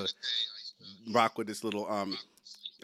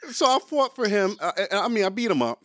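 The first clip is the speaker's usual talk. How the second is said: ordinary talk, nothing marked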